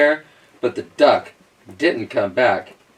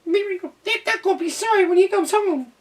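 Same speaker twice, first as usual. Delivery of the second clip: very high muttered grumble; muttering animals. A narrator's reading. Cat and squirrel muttering about the duck. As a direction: grumble it to each other. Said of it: "they muttered".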